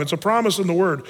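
ordinary talk, nothing marked

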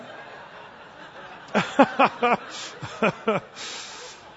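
laughing at his own joke; breathy laugh. Real, not acted. Laughs after the punchline.

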